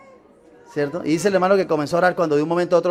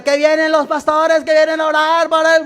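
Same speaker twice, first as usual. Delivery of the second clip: high, loud sentry's announcement; guard calling out; 9 semitones higher, 5 dB louder than usual. Retold or imitated. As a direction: pass the word on like a sentry calling out. Otra guardia repite el aviso. (guardia voceando, agudo y fuerte)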